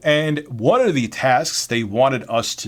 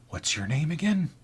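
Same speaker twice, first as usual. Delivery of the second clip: softer, raised-pitch question; odd coworker's introduction; 5 semitones higher, 6 dB quieter than usual. Acted. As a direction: ask it quietly, as the weird stock guy. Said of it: "introduced himself like this".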